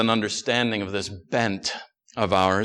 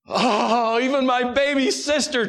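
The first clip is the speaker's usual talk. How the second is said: higher, wailing cry of dismay; lamenting; 11 semitones higher than usual. Acted. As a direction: lament it like a heartbroken kid. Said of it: "Charlie laments".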